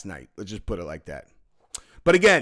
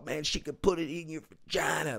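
strained, held-back imitation of a yeller; mock scream; 2 semitones higher, 8 dB quieter than usual. Retelling the insane guy heckling girls, softer mock scream. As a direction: imitate a screamer without the volume. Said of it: "screaming at the top of his lungs".

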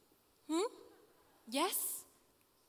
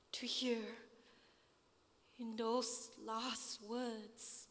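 slow, soft, fading almost to a whisper; hushed hurt; 2 semitones lower, 9 dB quieter, slower than usual. Acted. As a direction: let it sink into a pained hush. Hurt recalling his last words.

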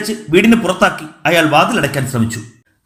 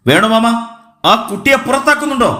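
fast, high-pitched, heated demand; angry retort; 6 semitones higher than usual. A narrator's reading. Man demands uncle put the child out, angrily. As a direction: lash out impatiently at the uncle. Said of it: "Hemachandran കയർത്തു".

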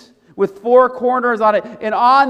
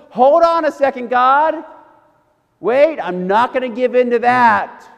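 loud, higher-pitched protest; Peter; 3 semitones higher, 5 dB louder than usual. Acted. Peter refusing God's command, loud indignant objection. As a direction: push back firmly, stopping God short. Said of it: "And Peter, trying to know".

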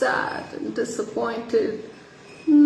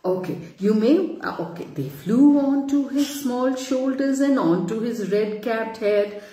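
plain reading voice

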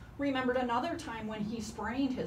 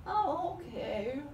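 sleepy, then a yawn; 7 semitones higher than usual. Acted. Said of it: "Koala said sleepily".

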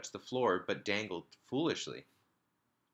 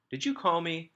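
put-on little boy; 10 semitones higher, 4 dB louder than usual. A narrator's reading. Little boy asking mother.

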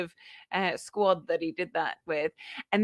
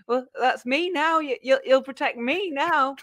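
put-on shy boy voice; 6 semitones higher, 3 dB louder than usual. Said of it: "then he goes".

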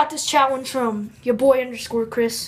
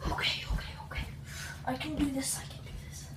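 soft whisper to push through pain; whispered pep talk; 13 dB quieter, much faster than usual. Real, not acted. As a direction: psych yourself up under breath. Enduring ice.

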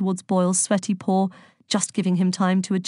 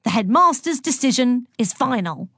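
higher-pitched, emphatic insistence; stern verdict; 6 semitones higher, 3 dB louder than usual. A narrator's reading. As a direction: close the argument firmly. Angry teacher lays down the law.

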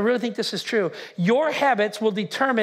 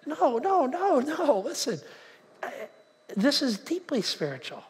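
high, sing-song string of objections; protesting denial; 5 semitones higher than usual. Retold or imitated. Pastor answering the doubting churchgoer, high protesting. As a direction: wave off the complaint, pitched up. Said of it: "And I'm like".

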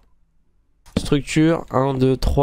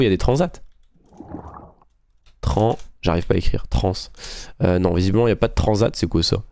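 usual speaking voice

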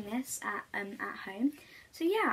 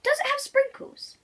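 very high, squeaky eager question; little creature; 4 dB louder than usual. Acted. Creature asking the wolf about sprinkles, very high. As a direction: perk up at the treat. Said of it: "the little creature asks".

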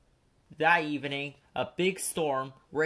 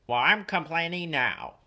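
grumpy snap back; 4 semitones higher, 6 dB louder than usual. A narrator's reading. Cranky snapping back.

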